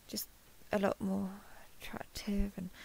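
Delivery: embarrassed under-the-breath whisper. Real, not acted. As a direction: trail off into a whisper. Flustered compliment slipping out.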